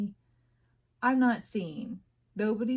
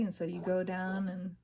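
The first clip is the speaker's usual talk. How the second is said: ordinary talk, nothing marked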